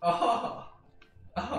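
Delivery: high mock German villain laugh; Hans Gruber. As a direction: imitate a film villain's laugh. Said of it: "unless they're like a Hans Gruber".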